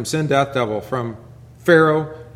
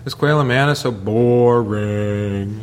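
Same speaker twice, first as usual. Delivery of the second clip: grumbling Israelites. Retold or imitated. Preacher mocking the grumbling Israelites, whiny and drawn out. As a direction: moan like bored people in the desert. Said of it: "They grumbled".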